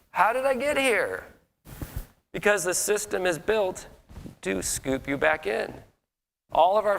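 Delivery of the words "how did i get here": much higher, exasperated How did I get here. Retold or imitated. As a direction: whine the line at yourself in disbelief. Exasperated at himself.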